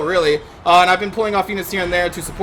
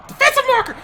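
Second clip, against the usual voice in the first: "Defensive marker" jumps way higher in a shrieking yelp; squeaky outraged yelp; faster than usual. Shown as a demonstration. Gamer reading his tooltip, squealing in outrage.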